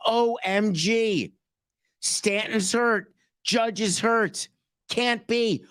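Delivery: high, slow, drawn-out fake shock; mock surprise. GM feigning shock at injuries, high slow mock surprise. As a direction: ham up the disbelief. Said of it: "the surprise they show".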